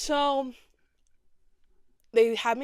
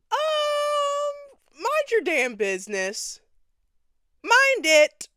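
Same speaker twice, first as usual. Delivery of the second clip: mock loud retort; 8 dB louder, much slower than usual. Retorting to nosy woman.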